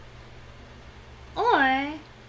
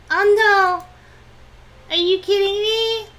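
loud, high-pitched disbelief; dismayed outburst; 7 semitones higher, 9 dB louder than usual. Real, not acted. Player hit by a bad turn, dismayed outburst. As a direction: cry out in protest.

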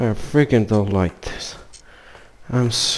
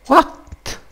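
startled yelp; 4 dB louder than usual. Real, not acted. Startled shout.

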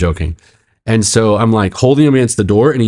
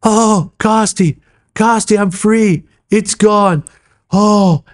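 high, drawn-out cry of release; imitated ecstatic relief; 13 semitones higher, slower than usual. Man being prayed over tells pastor, high imitated relief. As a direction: play sudden deliverance, voice way up and stretched.

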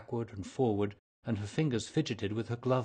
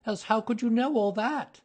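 higher and louder, startled exclamation; cried out; 13 semitones higher, 7 dB louder than usual. A narrator's reading. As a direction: burst out in alarmed surprise. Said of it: "she cried".

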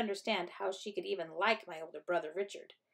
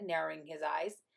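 ordinary delivery, nothing marked

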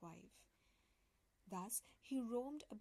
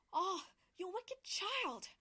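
slow, much higher and louder furious witch cry; angry cry; 8 semitones higher, 7 dB louder, slower than usual. Acted. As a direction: hurl it at the child in fury. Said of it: "cried the enchantress".

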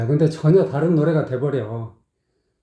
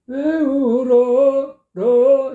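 high, slow imitation splitting 울어 into 러; imitating the singer's bad break on 울어; mock wrong take; 10 semitones higher, 3 dB louder, much slower than usual. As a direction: parody the wrongly split syllable. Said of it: "이렇게 하고 있죠".

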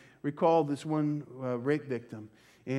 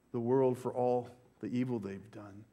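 usual speaking voice

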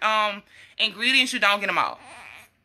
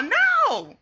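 high, sharp disbelief; No! shot up high in disbelief; incredulous; 13 semitones higher than usual. Real, not acted. Rejecting the imagined accusation.

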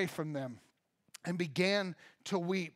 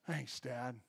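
low, soft, deadpan sarcasm; sarcastic thanks; 6 semitones lower, 8 dB quieter than usual. Pretend. Brothers answering their father, soft sarcastic thanks. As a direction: mutter it like the overlooked son.

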